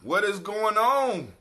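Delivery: loud, slow exasperated cry; exasperated outburst. Real, not acted. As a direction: belt out the disbelief. Frustrated at heartburn.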